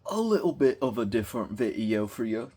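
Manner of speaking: awkwardly put-on British accent. Real, not acted. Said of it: "Don't know why we went the British accent".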